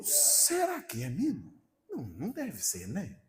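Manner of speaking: slow, drawn-out doubt, voice sliding up and down; hesitant wondering. Retold or imitated. Preacher recalling his doubt on sensing God's presence, hesitant wondering. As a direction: wonder it softly to yourself.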